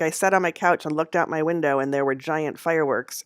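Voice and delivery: usual speaking voice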